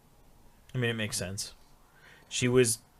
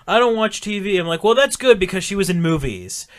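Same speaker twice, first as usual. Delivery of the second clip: higher and louder, sarcastic comeback; mock indignant retort; 9 semitones higher, 9 dB louder than usual. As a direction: snap it back with sarcasm. Retelling exchange with young coworker.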